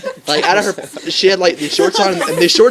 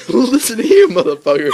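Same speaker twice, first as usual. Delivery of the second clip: retorting; 6 semitones higher, 3 dB louder than usual. Retold or imitated. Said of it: "and I'm like".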